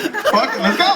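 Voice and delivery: mock eager. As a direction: fire yourself up. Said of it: "I was like".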